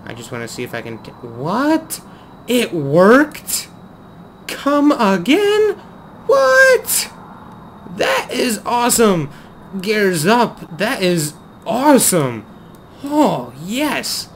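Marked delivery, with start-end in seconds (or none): 1.1-7.1 loud, high, escalating astonishment; incredulous shrieked questions; amazed disbelief. Real, not acted. Plane unexpectedly works. 8.0-14.3 loud, high-pitched delight; thrilled exclaiming; elated. Real, not acted. Delighted that it works.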